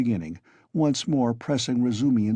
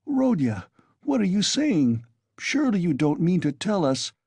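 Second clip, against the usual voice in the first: alarmed; 8 semitones higher than usual. A narrator's reading. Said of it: "began in alarm".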